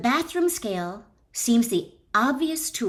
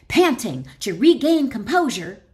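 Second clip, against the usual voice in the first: mock breathless; 4 semitones higher, 7 dB louder than usual. Narrated panting after the struggle.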